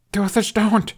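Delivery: high, loud anguished plea; desperate cry. A narrator's reading. Hiccup begging Toothless just before impact, anguished cry. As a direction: scream it as the ground hits.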